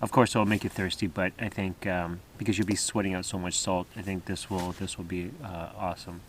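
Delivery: hushed morning talk at camp; quiet voice. Real, not acted. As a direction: keep it low so nobody wakes. Early morning, others still sleeping.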